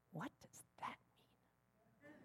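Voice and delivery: near-whisper, very soft; hushed puzzled question. Retold or imitated. Daughter's friend puzzled by the sign, hushed question. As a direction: ask it in a barely voiced hush.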